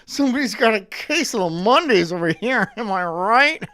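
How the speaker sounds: hammed-up cheery coworker; mock office cliché. Shown as a demonstration. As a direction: ham up a cubicle-joke guy. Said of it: "Shimo says".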